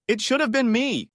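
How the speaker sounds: very high, pleading outburst; cracking yell. A narrator's reading. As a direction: wail it high like a heartbroken drunk. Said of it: "he yells, his voice cracking".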